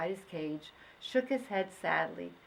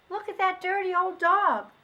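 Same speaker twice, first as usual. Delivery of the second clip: put-on much higher, louder child voice; a kid jeering at a stray; mocking child; 12 semitones higher, 8 dB louder than usual. Child mocks the dog.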